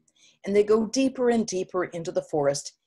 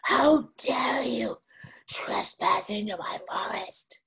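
slow, rough monster voice, starting higher; bigger monster; 4 semitones higher, slower than usual. Acted. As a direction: draw out the threat like a giant monster. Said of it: "even bigger".

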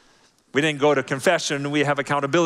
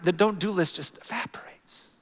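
whisper; 4 semitones higher, 6 dB quieter than usual. Acted. Whispered point in sermon.

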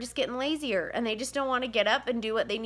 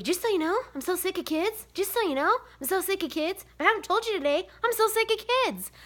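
texting husband; 8 semitones higher than usual. Retold or imitated. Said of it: "every day I text Cody".

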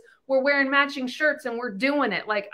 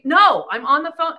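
put-on snapping at kids; 4 semitones higher, 8 dB louder than usual. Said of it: "And you hear them come in".

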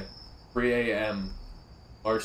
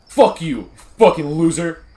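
angry shout; 4 semitones higher, 10 dB louder, slower than usual. Real, not acted. Cursing at the NPC.

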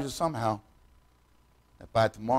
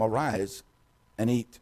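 quiet, gentle wake-up call; soft angel voice; 3 semitones lower, 7 dB quieter than usual. Acted. Preacher voicing the angel waking Elijah, soft and gentle. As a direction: hush it like an angel stirring a sleeper.

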